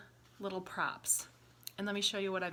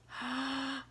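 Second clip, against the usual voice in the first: mock gasp; 6 semitones higher, 4 dB quieter than usual. Bunny appears in the story.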